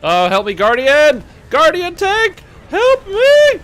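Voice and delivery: high, loud damsel-in-distress cries; fluttering cries for help. Pretend. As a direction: shriek for the Guardian tank to save you. Mock plea to Guardian tank.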